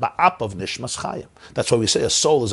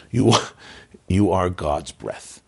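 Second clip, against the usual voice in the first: tender reassurance; 2 semitones lower, slower than usual. Real, not acted. Reassuring listener after the list.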